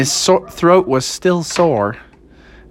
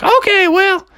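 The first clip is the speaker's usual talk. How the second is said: high falsetto, eager cartoon mother; high-pitched keen mom; Froggy's mom. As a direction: gush in a squeaky mom voice. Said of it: "she's like".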